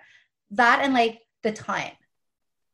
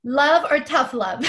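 laughing; 5 semitones higher, 5 dB louder, slower than usual. Real, not acted.

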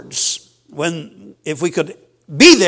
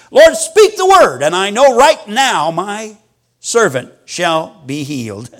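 emphatic plea; 3 dB louder than usual. Acted. Centurion voiced with emphatic plea.

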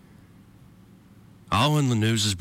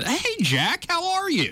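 high-pitched greeting. Acted.

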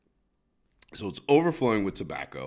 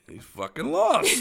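mocking cop; 7 dB louder than usual. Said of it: "be like".

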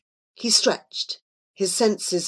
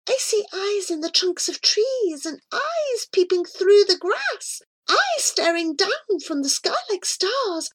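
very high, hushed creature voice; awed Miss Gory; 12 semitones higher than usual. A narrator's reading. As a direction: marvel in a tiny high voice. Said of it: "Miss Gory whispered".

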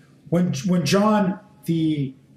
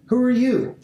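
higher, pressing question; priests demanding; 6 semitones higher than usual. Acted. Priests from Jerusalem question John, pressing. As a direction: press him for an answer.

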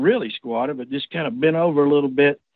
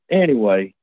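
normal talking voice

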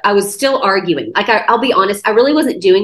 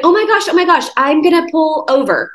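alarmed; 7 semitones higher than usual. Retold or imitated. Shocked at finding her crying.

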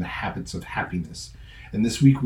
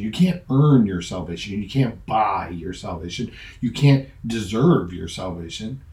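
ordinary delivery

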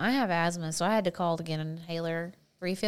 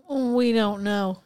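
higher, drawn-out doctor's shrug; lightly mock a clueless doctor, slow and louder; 4 semitones higher, 5 dB louder, much slower than usual. Doctor giving a useless diagnosis, mildly mocking voice.

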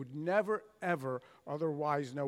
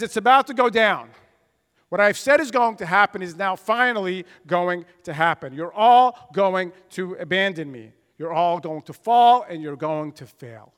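loud, urgent warning in Jesus' voice; voicing Jesus; 12 dB louder than usual. Preacher voices Jesus warning the disciples they will fall, loud and urgent. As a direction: announce the coming fall, forcefully. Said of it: "So Jesus says".

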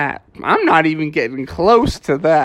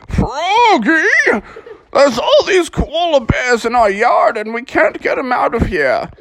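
put-on high squeaky old-parent voice; old parents complaining; 11 semitones higher than usual. Old parents complaining to Froggy about koalas, high squeaky voice. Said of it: "his mom and dad were like".

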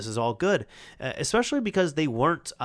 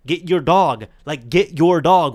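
much louder, forceful command to get the dog; firmer; 4 semitones higher, 11 dB louder than usual. Retold or imitated. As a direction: bark the order, losing patience, slower. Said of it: "and I'm kinda getting firmer as well".

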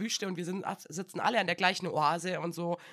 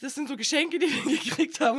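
cheerful colleague, laughing; 8 semitones higher, 8 dB louder than usual. Retold or imitated.

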